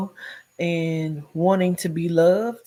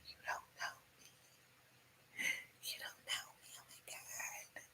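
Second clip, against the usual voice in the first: breathless whisper; whispering to herself in bed beside him; 27 dB quieter than usual. Retold or imitated. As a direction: whisper it in fear, barely audible.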